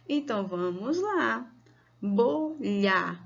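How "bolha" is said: "bo-lha" in two drawn-out beats; syllable by syllable. Shown as a demonstration. Teacher starting syllable practice, syllable by syllable. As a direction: separate both syllables of "bolha". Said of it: "vamos separar as sílabas".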